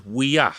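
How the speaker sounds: Wea said clearly for him; Cree word demo. Shown as a demonstration. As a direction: model Wea for the class. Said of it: "I'll say".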